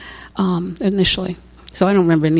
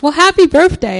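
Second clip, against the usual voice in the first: cheerful nurse; 7 semitones higher, 6 dB louder than usual. Retold or imitated. Nurse greets her cheerfully.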